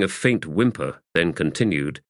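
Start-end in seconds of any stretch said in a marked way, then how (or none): none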